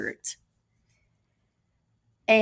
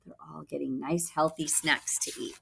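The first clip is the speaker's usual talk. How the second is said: hushed aside whisper; 2 semitones lower, 6 dB quieter than usual. Real, not acted. Aside to the class.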